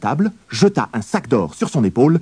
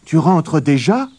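higher, slow questioning mother; mother's voice; 8 semitones higher, 6 dB louder, slower than usual. A narrator's reading. Mother surprised her son is already home. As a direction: ask it slowly in a raised mother's voice. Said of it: "demanda sa mère".

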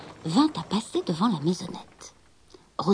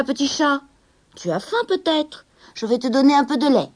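warm, a bit higher and louder offer; kindly old man; 5 semitones higher, 6 dB louder than usual. Acted. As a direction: coax the stray cat gently. Vieil homme offre du lait. (vieil homme bienveillant, voix un peu plus haute)

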